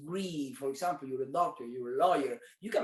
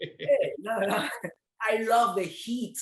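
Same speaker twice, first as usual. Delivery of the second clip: louder, higher, laughing protest; playful loud defence of the heat; excited insistence; 4 semitones higher, 5 dB louder than usual. Real, not acted. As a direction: insist with real glee that you love it.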